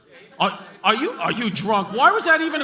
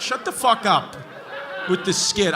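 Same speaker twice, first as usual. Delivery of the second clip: normal talking voice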